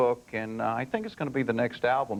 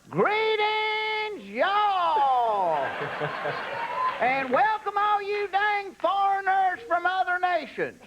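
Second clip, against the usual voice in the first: much higher, slow hammed-up Southern drawl; much slower than usual. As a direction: greet the world like a country ceremony host. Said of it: "see the guy out there going".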